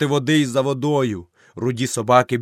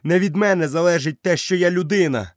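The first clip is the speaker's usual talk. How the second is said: high, loud protest; boy's desperate loud protest; shouting; 7 semitones higher, 4 dB louder than usual. Acted. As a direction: cry out in denial. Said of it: "закричав Мауглі".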